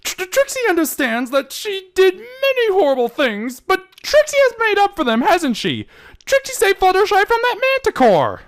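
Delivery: high, stammering, earnest plea; pleading. Acted. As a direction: beg to be believed. Trixie pleads her case.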